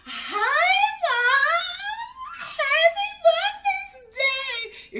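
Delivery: very high, loud squealed greeting; mock your daughter in a drawn-out, piercing singsong; proper-talking daughter. Mom imitating baby girl arriving with strawberries, high proper squeal. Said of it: "She talks so proper".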